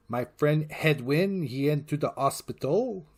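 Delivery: English with Quebec French intonation; demonstrated French-accented English; French-Canadian accent. Shown as a demonstration. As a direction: imitate a francophone Quebecer speaking English, slowing on the name. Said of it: "an English way with French intonation".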